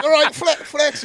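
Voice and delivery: high, loud, slowed mock Welsh accent; terrible Welsh accent. Imitating the big Welsh strongman out of the pool, terrible Welsh accent. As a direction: attempt a hammed-up Welsh accent, badly. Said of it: "That was a terrible Welsh accent".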